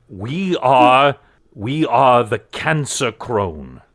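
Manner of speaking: slow, grandiose proclamation; pompous cultist. Acted. Cult member announcing his order to captors, slow and grandiose. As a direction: boast with drawn-out self-importance. Game master plays boastful cultist.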